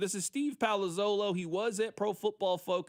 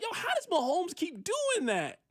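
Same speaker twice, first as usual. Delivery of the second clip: lightly voiced fan, higher and baffled; mock disbelieving fan; amazed rival fan; 10 semitones higher than usual. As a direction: mimic a stunned fan asking how. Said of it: "they look like".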